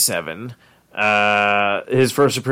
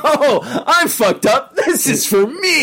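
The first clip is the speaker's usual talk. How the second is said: much higher, slow, laughing; mock self voice; 12 semitones higher, slower than usual. Podcaster mocking his own reaction to the show, high and slow. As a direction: confess it gleefully. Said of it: "I'm like".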